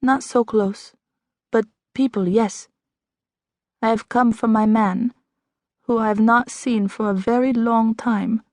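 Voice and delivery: put-on French accent, stranger answering the doctor. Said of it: "What's that accent?".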